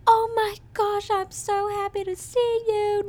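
high, slow, gushing happy voice; mock gushing delight. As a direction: squeal it like a thrilled girlfriend. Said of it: "Like, not even like".

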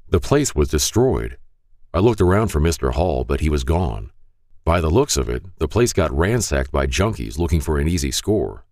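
ordinary delivery

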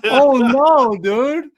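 laughing out oh no. Real, not acted. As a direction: laugh through the words. Host laughs at frozen guest.

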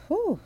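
long sighed whew rising high; unvoiced exhale, then a high whew; breathy whew. Real, not acted. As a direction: blow out a long tired whew. Sigh after anxious rant.

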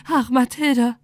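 tearful cry of grief; tragic heroine sorrow. Acted. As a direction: sob out her name. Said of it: "schluchzte sie".

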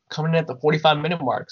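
usual speaking voice

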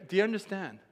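normal talking voice

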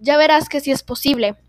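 plain reading voice

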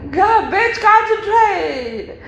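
high, drawn-out telling-off of yourself; real high-pitched self-rebuke; exasperated self-scolding. Real, not acted. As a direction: scold yourself in a high voice, stretching the last word. Scolds herself after fluffing a line.

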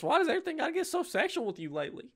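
high, fast mock exasperation; teasing. Character teasing the sheriff about being sexual.

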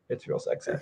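hushed teasing whisper. Acted. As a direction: breathe it out as a tease. Teasing aside about the kiss.

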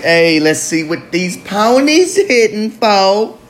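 playful imitated urge to bet; tempted gambler. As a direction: tease it like the itch just kicked in. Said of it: "and I'll be like".

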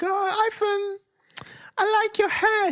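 very high girly mock voice; high-pitched fawning schoolgirl; admiring schoolgirl. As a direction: imitate a girl swooning over his hair. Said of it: "the girl's gonna be like".

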